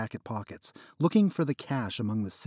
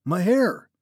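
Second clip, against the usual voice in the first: higher, louder pained cry; distressed cry; 8 semitones higher, 9 dB louder than usual. Acted. Trapped friend crying out about his frozen hair.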